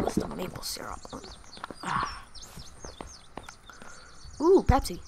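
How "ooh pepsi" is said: ordinary delivery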